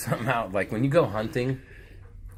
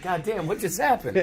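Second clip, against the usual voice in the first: higher, laughing disbelief; stunned laughing; 7 semitones higher than usual. Pretend. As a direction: sound floored by what just happened. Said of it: "You're like".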